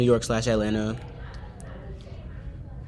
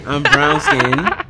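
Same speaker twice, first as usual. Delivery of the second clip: much higher, louder, slow laughing objection; laughing protest; 10 dB louder, much slower than usual. Real, not acted. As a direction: insist indignantly while laughing. Co-host protesting skin tone teasing.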